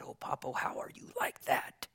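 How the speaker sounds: soft, half-whispered question; hushed asking. Retold or imitated. Asking Papa on the fishing trip, hushed. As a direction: imitate a hushed, intimate question to an elder. Retelling a talk with dying Papa.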